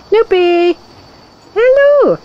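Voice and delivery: high, bright called hello; sing-song hello. Real, not acted. Owner calling hello to her dog.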